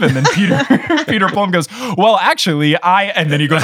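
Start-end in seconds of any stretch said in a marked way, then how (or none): 2.0-3.0 put-on higher, louder self-important kid; laughing, pitched-up boast; mock know-it-all. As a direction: start proudly correcting someone. Acting out kid detective boasting.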